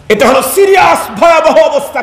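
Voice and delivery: sudden high fast shout of alarm; warning shout. Real, not acted. Preacher warns the congregation, shouting. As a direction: shout the danger at the crowd.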